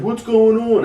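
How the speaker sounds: high, loud mock-innocent question; clueless bus driver. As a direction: play dumb, way up in pitch. Bus driver playing dumb.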